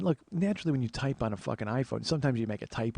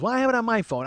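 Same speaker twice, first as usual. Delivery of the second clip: high, loud imitated aunt; eager aunt; 9 semitones higher, 5 dB louder, faster than usual. Aunt wanting a game with mom, eager aunt voice. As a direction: announce your plan as the aunt.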